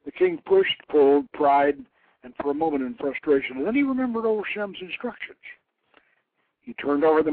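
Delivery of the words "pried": ordinary delivery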